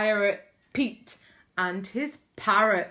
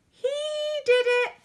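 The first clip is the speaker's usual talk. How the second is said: very high, loud bird squawk; parrot squawk; 5 dB louder, much faster than usual. A narrator's reading. Purple parrot blaming Pete for the hole, loud squawk. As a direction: screech it like a tattling parrot.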